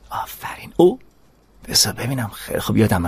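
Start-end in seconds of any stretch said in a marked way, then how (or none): 0.1-0.7 soft whispered well done; whispered praise. Acted. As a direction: breathe the praise to the dog. (تحسین نجواگونه)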